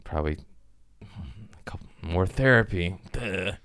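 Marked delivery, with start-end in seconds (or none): none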